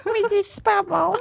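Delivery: put-on high baby-talk voice. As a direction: coo it like to a baby. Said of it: "quand tu fais le bébé".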